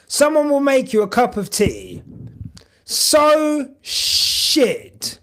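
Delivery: high, loud mock outrage; exaggerated complaint. Real, not acted. To stream chat about bad tea, high and loud.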